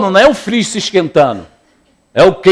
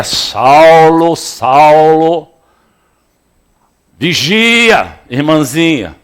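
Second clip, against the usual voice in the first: louder, drawn-out heavenly call; 4 dB louder, much slower than usual. Acted. A lightly voiced heavenly voice calling Saul, louder. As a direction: call out like God from the sky. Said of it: "ouviu uma voz que lhe dizia".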